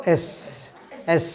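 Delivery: এস with a short snake hiss. Shown as a demonstration. Teacher models it for the class, hissing এস. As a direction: let just a little air hiss out after এস. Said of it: "just একটু বাতাস বেরোবে".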